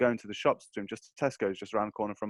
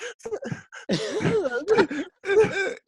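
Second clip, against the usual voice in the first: high laughter; 4 dB louder than usual. Real, not acted. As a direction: burst of high-pitched laughter. Fight story cracks him up.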